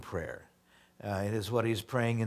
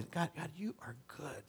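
soft whisper, God, you are good; whispered prayer; 4 semitones higher, 12 dB quieter than usual. Shown as a demonstration. Pastor modelling private prayer for the congregation, whispered. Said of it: "whispered prayer demo".

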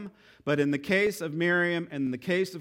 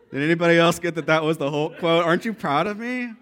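ordinary talk

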